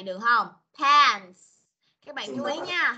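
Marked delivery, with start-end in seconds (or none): 0.8-1.4 loud "Pants" with hissed S; emphatic model "Pants", ending fully sounded; clear final S. Shown as a demonstration. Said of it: "mình phải có S ở ngay đây".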